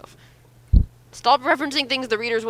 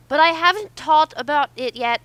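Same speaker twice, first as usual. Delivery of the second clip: put-on kid Master voice; 7 semitones higher, 6 dB louder than usual. Shown as a demonstration. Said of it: "that's too close to Wesley".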